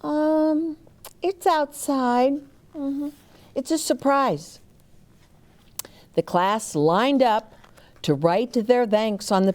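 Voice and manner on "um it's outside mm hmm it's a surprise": put-on high, hesitant boy hiding a secret; Sam's coy voice. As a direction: pitch up and hold back the surprise. Sam answers Mary Ann, coy.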